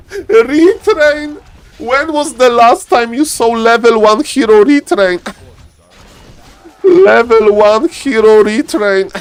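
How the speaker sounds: high, laughing disbelief through the words. Real, not acted. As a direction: crack up while asking it. Caster cracks up at retrain.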